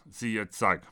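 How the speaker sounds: plain reading voice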